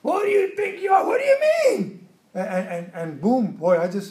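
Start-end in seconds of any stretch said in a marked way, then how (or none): 0.0-2.1 high-pitched indignant yell; retold yelling. Preacher showing how he blows up when accused, yelling. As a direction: lose it and shout, much higher than usual. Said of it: "and I start yelling".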